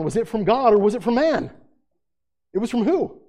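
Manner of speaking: high, loud, impassioned preaching to the congregation; full-force preaching. Acted. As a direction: preach it out, pressing them with questions.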